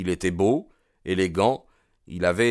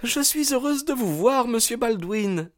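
very high, anxious female voice; panicked politeness in a high-pitched voice; flustered young woman. A narrator's reading. Hetty greeting the suitor who arrives early, flustered. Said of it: "Elle était plus que confuse, affolée".

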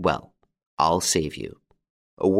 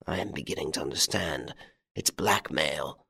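hushed, breathy whisper, much softer; 4 semitones lower, 8 dB quieter than usual. A narrator's reading. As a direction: whisper it in defeat. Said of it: "The Baron whispered".

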